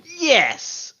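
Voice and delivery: a high, loud cheer. Pretend. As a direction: burst out with a triumphant yes. Reading Rose's cheer aloud.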